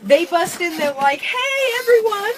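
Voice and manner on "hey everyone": high, lightly mocking announcement; mock boy-band greeting. Mocking a boy band bursting in, sing-out greeting. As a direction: imitate a cheery group hello.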